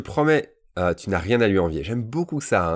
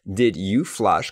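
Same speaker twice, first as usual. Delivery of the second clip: "did you flush" slowly, each word separate; careful slow teaching delivery of "did you flush"; 4 dB louder, much slower than usual. Shown as a demonstration. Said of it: "quand je le dis lentement".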